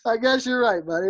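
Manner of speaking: high, amused, laughing through it; laughing reply. Retold or imitated. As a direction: concede to the heckler with a laugh. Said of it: "And I'm like".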